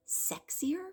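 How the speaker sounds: coy, teasing lift on sexier; playful sexier. Real, not acted. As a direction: tease the word sexier, high and sly. Listing benefits playfully.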